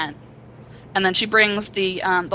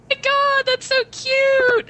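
high, slow, gushing squeal; moved friend squealing at a cute movie scene; slower than usual. Retold or imitated. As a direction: coo it way up high like it's adorable. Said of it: "That was my reaction".

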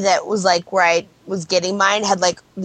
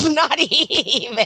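drawn-out laughing dismissal; 3 semitones higher, much slower than usual. Real, not acted. Co-host teasing about the pie, laughing. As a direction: laugh through the words, stretching them.

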